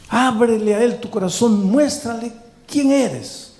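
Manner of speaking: high-pitched, pleading pastoral appeal; impassioned preaching plea. Real, not acted. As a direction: plead with them, voice lifted, drawing out key phrases.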